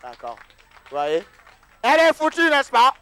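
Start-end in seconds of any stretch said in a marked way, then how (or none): 1.8-2.9 high, loud teasing cry; mock exclamation. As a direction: tease her loudly for the crowd. Pasteur taquine la fidèle devant la foule. (s'exclame fort pour taquiner la fidèle)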